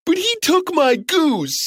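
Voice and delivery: put-on high mild whine of a giant kid; whining giant. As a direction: complain to mom. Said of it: "cried the giant".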